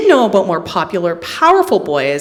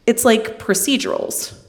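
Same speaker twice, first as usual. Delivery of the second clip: usual speaking voice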